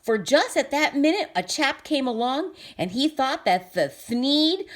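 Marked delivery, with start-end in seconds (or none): none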